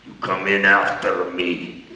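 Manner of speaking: put-on deep, slow accented wrestler; low gruff command in a foreign accent; wrestler's order. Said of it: "He goes".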